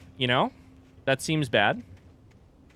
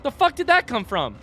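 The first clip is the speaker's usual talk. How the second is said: startled; 13 semitones higher, 6 dB louder than usual. Real, not acted. Enemy surprises in game.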